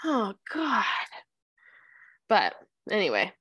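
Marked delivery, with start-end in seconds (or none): none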